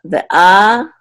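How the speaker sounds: ve'a with short ve and long loud a; secondary accent on a. Shown as a demonstration. Teacher showing the accent, ve'a with a held strong. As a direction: flick ve, lean on the a in ve'a. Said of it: "there's that secondary accent again".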